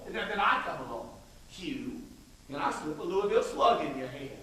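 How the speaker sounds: ordinary talk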